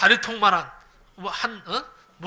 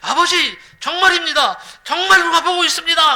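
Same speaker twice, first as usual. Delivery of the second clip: higher, louder pleading yell; boy shouting; 8 semitones higher, 8 dB louder than usual. Retold or imitated. Son shouting to his father that someone is really watching, loud plea. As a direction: insist loudly that it's true. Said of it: "소리를 지르는 거예요".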